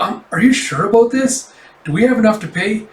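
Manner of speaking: high, anxious questioning; worried doubt. Acted. Staffer questions the cafe owner about the booking, worried. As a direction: push the worry upward into each question.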